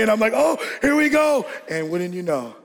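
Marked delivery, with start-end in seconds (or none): 0.4-1.5 high shout bracing for impact; mock panic. Boy bracing inside the sleeping bag, mock panic. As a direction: call out high as the crash comes.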